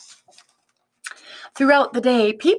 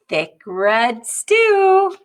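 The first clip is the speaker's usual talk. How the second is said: loud, very slow savoring; slow proud answer; 5 semitones higher, 6 dB louder, much slower than usual. Acted. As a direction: draw out each word with relish. Amu answers the vendor.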